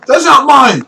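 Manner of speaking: loud, high-pitched excuse; guilty denial. Acted. Necromancer caught with a hand, high blurted denial. Suspect denying the hand found.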